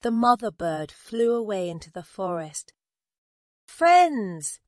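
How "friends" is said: high, loud hail; calling out. A narrator's reading. Mother bird to her friends, high loud call. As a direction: call to a crowd far off. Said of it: "she called out".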